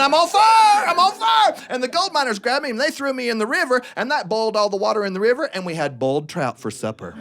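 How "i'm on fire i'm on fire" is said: high, loud yell of panic; panicked retold yell. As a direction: shout it as your pants burn. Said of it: "And I was yelling".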